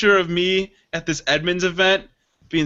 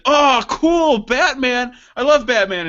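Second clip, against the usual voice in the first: higher, louder fanboy gush; mock starstruck enthusiasm; excited fan; 6 semitones higher, 3 dB louder than usual. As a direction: imitate a thrilled waiter spotting his hero. Voicing an excited waiter, Batman fan.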